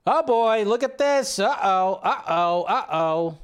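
high-pitched mock alarm; excited exclamation. Real, not acted. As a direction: react big with rising excitement, then uh-ohs. Excited reaction to an image.

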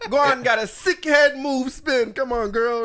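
loud, slow mock Jamaican hype; Jamaican accent. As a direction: cheer her on in a hammed-up Jamaican accent, loud. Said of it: "I got this thick accent".

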